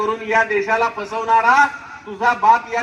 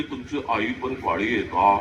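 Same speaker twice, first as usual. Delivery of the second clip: mocking student; 11 semitones lower, 5 dB quieter than usual. Mocking student asks about mother.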